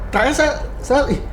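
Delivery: ordinary talk, nothing marked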